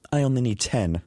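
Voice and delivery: high, sudden confident quip; quipping. A narrator's reading. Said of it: "Nadia quips".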